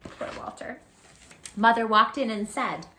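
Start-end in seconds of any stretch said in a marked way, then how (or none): 0.0-0.8 whispered sympathy; pitying whisper. Real, not acted. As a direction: murmur it with pity. Narrator's pitying aside.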